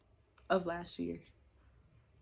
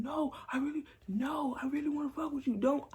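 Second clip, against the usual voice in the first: mocking pleading guy; 6 semitones higher than usual.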